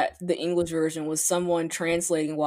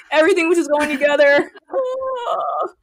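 overwhelmed by cuteness; 7 semitones higher, 7 dB louder than usual. Real, not acted. Gushing over idol's looks.

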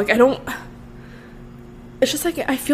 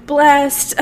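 mock carefree privileged girl; 5 semitones higher, 4 dB quieter than usual. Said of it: "here talking about how".

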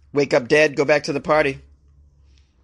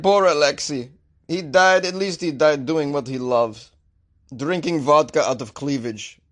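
mock-mourning in a bad Russian accent; hammed-up Russian mourner; terrible Russian accent. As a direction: put on a cheesy Russian accent. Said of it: "how terrible my Russian accent is".

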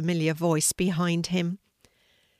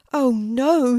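higher, louder cry of dismay; alarmed shout; 6 semitones higher, 8 dB louder than usual. A narrator's reading. Sidney to his fallen sister, alarmed shout. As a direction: call out in alarm. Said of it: "he shouted as he ran".

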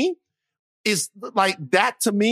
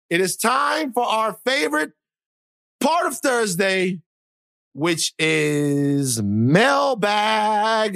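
higher, slow host announcement; announcer intro; 3 semitones higher, much slower than usual. Acted. Host announcing the mailbag segment, booming. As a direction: hype up the segment. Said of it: "show segment intro".

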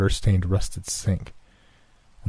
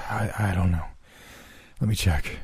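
quiet, sputtering between breaths; panting, winded words; 7 dB quieter than usual. A narrator's reading. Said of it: "I sputtered between heavy breaths".

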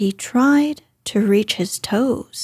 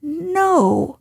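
Rabbit voice; 7 semitones higher than usual. Acted. Rabbit answers from inside.

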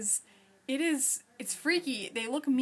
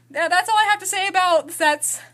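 loud cheerful voice; 4 semitones higher, 9 dB louder than usual. Real, not acted. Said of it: "change my tone of voice".

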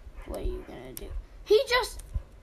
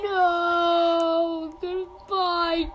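high, loud hammed-up farewell cry; mock sad wail; 6 semitones higher, 5 dB louder, much slower than usual. Player leaving the cute animals, mock sad wail. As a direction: wail goodbye in exaggerated grief. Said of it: "I'm sad".

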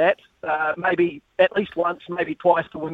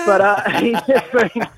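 laughing, breathless; 3 semitones higher, 3 dB louder, slower than usual. Real, not acted. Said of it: "out of breath 'cause I was just climbing the stairs".

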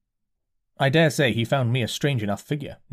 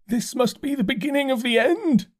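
dazed curate; 13 semitones higher than usual. A narrator's reading. Curate voiced by narrator.

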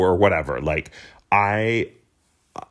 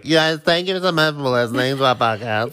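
put-on higher, drunken Brando mumble; drunk Marlon Brando; 5 semitones higher than usual. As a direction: slur it like tipsy Brando. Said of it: "you sounded like Marlon Brando".

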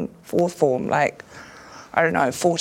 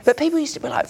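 ordinary talk

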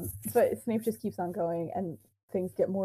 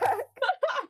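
short laugh. Real, not acted. Laughing after joke.

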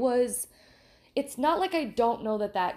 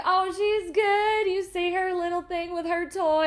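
proud grandma gush; 10 semitones higher, 4 dB louder than usual. Retold or imitated. Regular bragging about her granddaughter, sing-song mock voice. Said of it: "And she was like".

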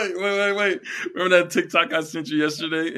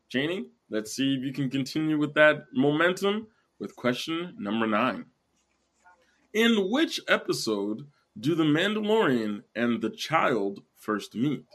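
usual speaking voice

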